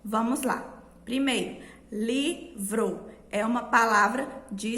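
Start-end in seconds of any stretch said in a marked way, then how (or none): 1.9-3.1 "livro" split slowly into li... vro; sílabas devagar. Shown as a demonstration. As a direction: say "livro" syllable by syllable, with a gap. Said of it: "falando todas as suas sílabas". ("livro" devagar, sílaba por sílaba)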